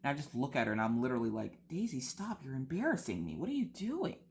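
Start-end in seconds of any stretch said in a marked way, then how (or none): 1.7-4.2 high-pitched, exasperated telling-off of a dog; embarrassed scolding. Retold or imitated. Owner to her big scared dog at the park, embarrassed scolding. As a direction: plead with the dog to stop showing you up.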